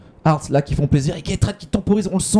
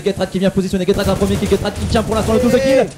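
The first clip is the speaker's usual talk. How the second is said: rising, hyped-up play-by-play; fighting game announcer; 9 semitones higher than usual. Real, not acted. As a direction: build from excited to screaming hype. Caster hypes triple kill play.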